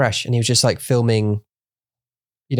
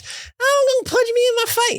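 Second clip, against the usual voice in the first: squeaky mock voice. Mocking reply in squeaky voice.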